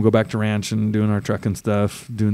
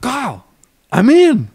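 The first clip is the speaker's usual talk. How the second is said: much higher, excited call to Kyle; excited news; 12 semitones higher, 4 dB louder than usual. Retold or imitated. Telling bandleader Kyle on the phone, excited. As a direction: announce it with a burst of joy. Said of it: "and I'm like".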